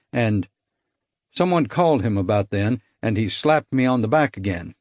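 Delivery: usual speaking voice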